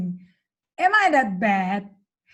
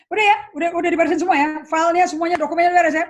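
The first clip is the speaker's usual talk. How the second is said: high, loud rushed bossing around; retelling her old curt boss voice to Mary; brusque orders; 5 semitones higher, 6 dB louder, much faster than usual. Retold or imitated. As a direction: bark it quickly at the secretary. Retells rushing past her secretary.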